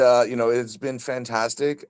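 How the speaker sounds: ordinary delivery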